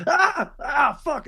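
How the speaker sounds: high-pitched cries, then a curse; high startled yelps of a felled busboy; pained yelp. Acted. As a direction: cry out high as if knocked flat.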